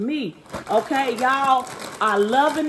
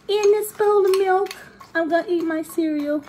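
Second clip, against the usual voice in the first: put-on high-pitched little kid voice; seven-year-old self; 6 semitones higher than usual. About to eat cereal, pretend seven-year-old voice. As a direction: announce it like a pretend child, pitched up. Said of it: "transitioning to my seven-year-old self".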